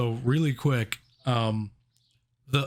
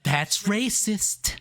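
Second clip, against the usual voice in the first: mock outrage; 9 semitones higher, much slower than usual. Host jokingly accuses Hollywood, slow mock outrage. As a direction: fake scandalized drawl. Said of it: "mock outrage".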